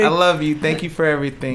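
high, loud, gushing. Pretend. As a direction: pour it on for the fan. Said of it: "Say hi again, [name]".

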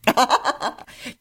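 high burst of laughter; amused laugh. Real, not acted. Laughs at guest's joke.

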